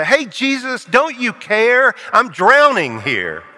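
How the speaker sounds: high, pleading cry for help; worried listener pleading with Jesus; desperate plea. Retold or imitated. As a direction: shake him awake in mock panic. Listener shaking Jesus awake.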